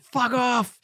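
high-pitched comic yelp of refusal; mock exasperated outburst. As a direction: snap back as the pestered star, hammed-up. Acting out Bale's exasperated reply.

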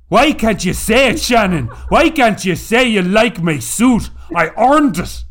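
loud, high, hammed-up Irish accent; Tom Cruise's bad Irish accent. Quoting Far and Away to Shannon, loud mock-Irish pleading. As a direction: plead at full volume in a terrible mock Irish brogue. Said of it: "It was the worst Irish accent".

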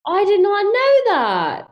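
high, loud, slow British protest; hammed-up British astonishment; fake British accent. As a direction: protest like a stunned mock Brit. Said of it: "with the British accent".

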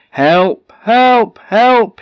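loud, high-pitched calls for help; crying out for help. Acted. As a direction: call out urgently, far across the water. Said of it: "he cried".

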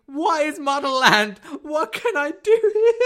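high, loud, slow mocking question; mock eager tourist. Reading the website FAQ aloud to her co-host, mock eager, high and slow. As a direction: ham up the clueless visitor's question, high and slow. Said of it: "question everyone's asking".

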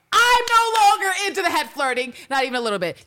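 high, loud mock outburst; Eve. Retelling what Eve says about het flirting, high emphatic outburst. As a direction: belt out an emphatic rejection, retold. Acting out Eve's blunt rejection.

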